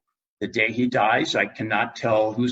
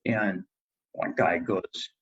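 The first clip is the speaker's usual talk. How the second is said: ordinary delivery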